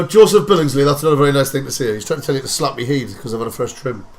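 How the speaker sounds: ordinary talk, nothing marked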